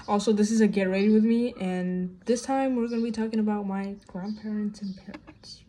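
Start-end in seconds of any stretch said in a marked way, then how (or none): none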